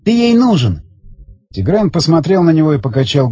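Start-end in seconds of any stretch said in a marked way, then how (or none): 0.0-0.8 higher-pitched character voice; raised, insistent man's voice; tense bomb holder. Acted. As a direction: explain it with strained urgency, pitched up. Voices Zagorsky pleading with Tigran.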